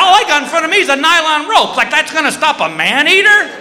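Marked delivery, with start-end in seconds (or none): none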